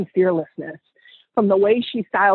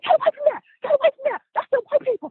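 very high-pitched panicked squeal, imitating friends; high mock shriek of alarm; mock screaming; much faster than usual. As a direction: squeal it like a horrified friend, hammed up. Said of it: "They screamed".

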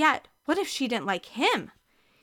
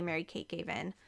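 plain reading voice

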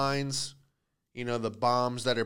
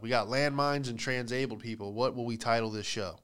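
usual speaking voice

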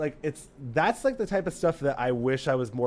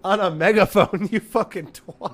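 higher and louder mocking comeback, laughing, slowing at the end; cheeky retort; 7 semitones higher, 9 dB louder than usual. Comic retells snapping back at the megaphone organizer, laughing defiant jeer. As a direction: sass her back with a laugh.

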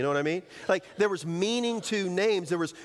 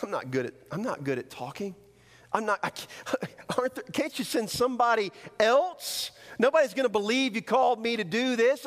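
hesitant stammer building to a higher plea; reluctant, halting, then insistent; protesting excuses; 6 semitones higher than usual. Acted. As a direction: plead your way out of it, stumbling at first. Acting out excuses to God.

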